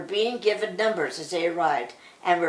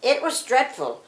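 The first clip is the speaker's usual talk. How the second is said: ordinary delivery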